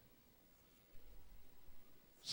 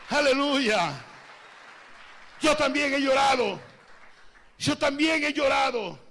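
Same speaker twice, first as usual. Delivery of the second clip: loud, high-pitched preacher's cry; fervent pulpit declaration; impassioned preaching; 7 semitones higher, 9 dB louder than usual. Real, not acted. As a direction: preach at full force, raised in pitch and volume. Preaches at full force.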